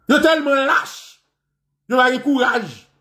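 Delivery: emphatic repeat. Real, not acted. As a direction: raise the pitch and stretch out the accusation. Repeats accusation with raised pitch.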